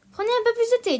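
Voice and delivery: high, loud, pressing offer; eager. A narrator's reading. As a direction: urge it on the guest eagerly. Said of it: "avec empressement".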